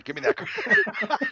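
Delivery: demanding son. Retold or imitated. Dad voicing son demanding card.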